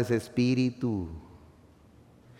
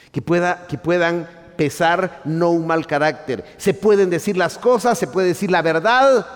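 much higher and louder, full-force sermon delivery; fiery preaching; 7 semitones higher, 7 dB louder than usual. Acted. Pastor proclaiming to the congregation that God will change our character, fiery.

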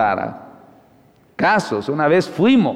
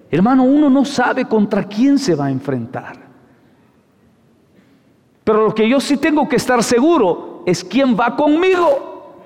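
preaching at full force; 6 semitones higher than usual. Acted. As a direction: drive the message home from the pulpit. (predicación con fuerza, voz alta)

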